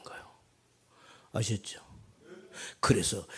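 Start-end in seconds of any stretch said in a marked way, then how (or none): none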